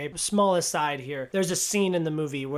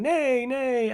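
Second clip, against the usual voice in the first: high, playful spoken neigh; mock horse voice; 8 semitones higher, 4 dB louder than usual. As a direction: say it as a silly horse, pitched up. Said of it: "my version of a horse neighing".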